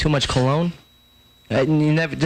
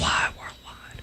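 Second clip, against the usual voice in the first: whisper; 10 semitones lower, 9 dB quieter, slower than usual. Pretend. Echo-style whispered aside.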